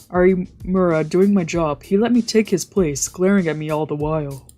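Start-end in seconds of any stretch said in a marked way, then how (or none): none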